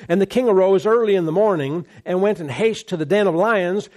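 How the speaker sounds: usual speaking voice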